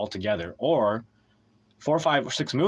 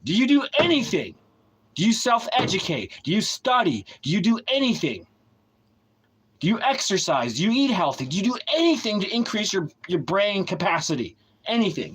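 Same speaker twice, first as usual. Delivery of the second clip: scolding questions; 6 semitones higher than usual. Real, not acted. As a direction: fire each question at them. Berating viewers with questions.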